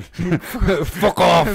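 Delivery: mock German accent, drawn out. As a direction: imitate a German saying it. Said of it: "That almost sounded German".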